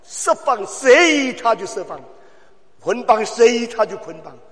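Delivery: high, shouted sermon climax; shouting preacher rallying the church; fiery preaching. Acted. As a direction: hammer each short phrase at the hearers. Preaching at full force.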